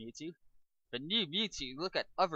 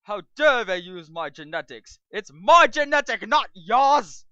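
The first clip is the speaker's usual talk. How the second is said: higher, louder furious protest; outraged rant; 8 semitones higher, 15 dB louder than usual. Acted. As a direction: rage at the theft. Mewtwo outraged.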